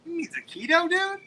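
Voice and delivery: high-pitched, loud disbelief; loud, very high retort to a friend; incredulous correction. Retold or imitated. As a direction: squeal the correcting question, voice shooting up. Mock disbelief at a friend's mispronunciation.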